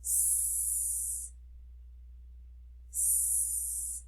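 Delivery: a long unvoiced sss; hissed s sound. Shown as a demonstration. Teacher sounding out a whispered sss for the class. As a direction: hiss the s sound with no voice. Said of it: "Last sound".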